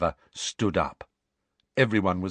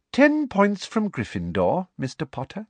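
smug penalty; 12 semitones higher than usual. A narrator's reading. Professor docking Harry's house points, smug. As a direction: pronounce the punishment with prim satisfaction. Professor punishes student with satisfaction.

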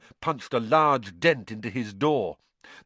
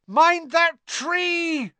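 slow, high bellowed warning; bellowing; 4 dB louder, much slower than usual. Acted. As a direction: bellow it out in alarm. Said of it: "Harry bellowed".

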